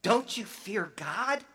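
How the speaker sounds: the second criminal rebuking the first, high and stern; rebuke. Acted. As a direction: call him out sharply. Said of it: "the other criminal rebuked him".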